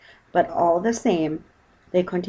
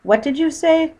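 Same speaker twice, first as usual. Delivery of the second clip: high, louder call back; 11 semitones higher, 7 dB louder than usual. Acted. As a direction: shout from the water. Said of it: "called Paddington".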